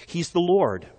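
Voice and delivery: normal talking voice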